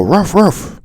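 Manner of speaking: high voiced dog barks; mock barking. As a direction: imitate a dog's bark in words. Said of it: "they go".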